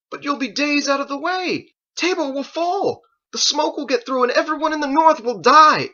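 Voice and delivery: high, loud, desperate warning; frantic protest. Acted. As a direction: plead that everyone will die. Joseph protests the detour.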